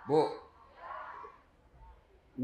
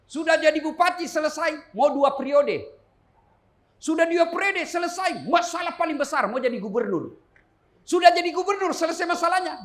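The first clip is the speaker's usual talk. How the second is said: each sudah called out high and loud; building preacher's cadence, loud on each step; rising preaching; 8 semitones higher, 6 dB louder than usual. Acted. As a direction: hammer every rung of the ladder. Preaching climb up career ladder.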